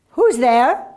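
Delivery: sick woman calling from within. A narrator's reading. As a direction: call out as an ailing woman behind a door.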